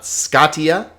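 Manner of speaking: scatia as SKA-ti-a, high and rising; wrong first-syllable stress. Shown as a demonstration. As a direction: hit the start of scatia. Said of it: "pay attention to the accents".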